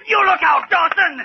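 high furious shout. Acted. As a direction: yell the warning hard. Fight breaks out aboard.

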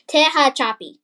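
loud, deliberate Tehachapi; emphatic pronunciation. Shown as a demonstration. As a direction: sound out "Tehachapi" loudly and firmly. Practising a hard place name.

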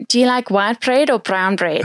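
put-on South African accent. Shown as a demonstration. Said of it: "can you still fake it?".

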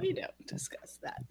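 breathy stage whisper. Pretend. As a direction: whisper it like a secret. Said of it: "echoing the aside".

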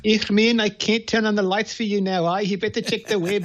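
put-on teasing voice-assistant line in a South African accent. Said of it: "I love it when you do that accent".

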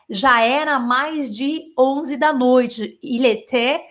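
slow translation. Shown as a demonstration. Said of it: "slow translation".

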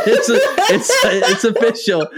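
ordinary talk, nothing marked

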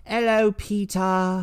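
put-on high, slow effeminate British accent. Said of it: "In the British accent?".

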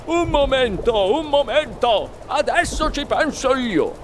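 hurried reassurance in a put-on voice; agitated husband. A narrator's reading. Husband taking off his jacket to dive in, agitated. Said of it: "disse concitato il signor Gloop".

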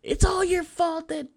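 very high, slow, shrieking blame; hammed-up furious wife; wife flipping out at her husband, mock shrill anger; mock flip out. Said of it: "she just flips out".

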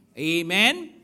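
ordinary talk, nothing marked

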